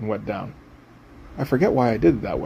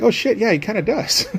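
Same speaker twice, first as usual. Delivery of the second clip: surprised realization; 8 semitones higher than usual. Retold or imitated. Realizing the drawing resembles Trejo.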